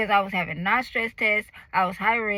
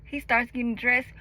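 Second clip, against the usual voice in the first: ordinary talk, nothing marked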